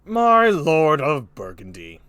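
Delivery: slow, loud regal address; King Lear. Acted. King Lear addressing Burgundy, loud slow kingly voice. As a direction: boom it out slowly like an old play's king. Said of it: "Here's King Lear again".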